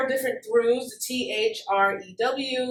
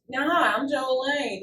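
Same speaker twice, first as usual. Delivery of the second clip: slow, held-back delivery with no effort; unanimated character voice; slower than usual. Shown as a demonstration. Showing the host the wrong way, unanimated. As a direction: say it sitting still, not committing to the character. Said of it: "there's no way you can just sit".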